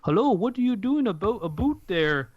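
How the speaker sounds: Canadian impression. Canadian mocking how Americans imitate Canadians, hammed-up Canadian accent. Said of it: "the Canadian impressions".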